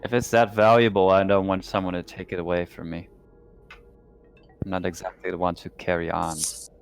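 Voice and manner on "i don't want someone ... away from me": ordinary talk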